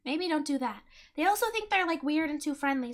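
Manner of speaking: ordinary delivery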